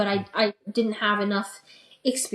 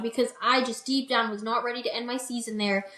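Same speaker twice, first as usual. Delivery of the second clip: ordinary delivery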